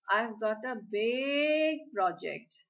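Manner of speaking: slow, with "big" drawn out long; stretched big. Shown as a demonstration. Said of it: "I'm stretching it now".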